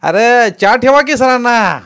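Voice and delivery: loud, high, slow call across the house. A narrator's reading. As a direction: shout to the kitchen for tea. Grandmother calls out for tea.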